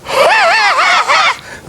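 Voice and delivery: high mechanical shout-laugh; robot laugh imitation. Imitating Bobby May's robot laugh for the audience: high shouted laugh. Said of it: "you heard a ... something like that".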